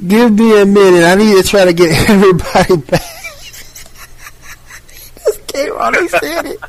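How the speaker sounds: thrilled host scrambling, giggling; excited laughing host. Real, not acted. Host thrilled by returning caller.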